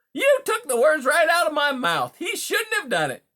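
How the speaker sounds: high, loud, pushy patter; brash, indignant beaver. Acted. Beaver complains of a break-in at his lodge, brash and indignant. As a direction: lay down the law, loud and high.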